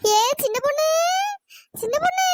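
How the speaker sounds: high wailing call of a ghost; a faint crying edge in the ghostly call; eerie ghost call. Acted. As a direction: call out like a spirit luring them. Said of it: "பங்களாக்காரி தான் வேற குரல்ல கூப்பிடுறாளோ".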